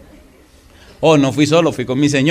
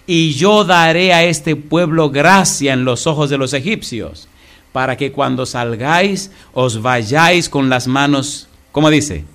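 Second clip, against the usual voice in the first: raised, slow preaching read; scripture reading; 4 dB louder, slower than usual. Acted. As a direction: proclaim the verse to the congregation.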